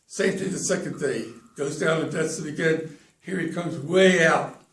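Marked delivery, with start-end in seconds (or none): none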